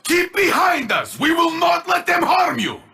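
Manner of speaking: high, ringing war cry; battle shout. Acted. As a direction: bellow it over the fight to shield them. Battle cries protecting the Guardian.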